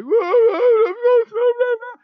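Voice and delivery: put-on high wailing cry of a distraught little boy; imitating a wailing child. Father imitates his son's loud wailing sobs. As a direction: wail it like a sobbing kid. Son crying over broken dish.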